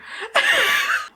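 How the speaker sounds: helpless high giggle; high-pitched laugh. Real, not acted. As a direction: crack up, voice shooting high. Cracks up at own slip.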